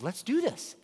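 high-pitched, excited retold exclamation; eager resolve. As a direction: act out a sudden, eager yes. Couple's sudden eager decision, acted.

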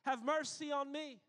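high, loud desperate cry; shout. A narrator's reading. Blind beggar crying out to Jesus, loud and high. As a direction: call out to be heard over the crowd. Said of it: "he began to shout".

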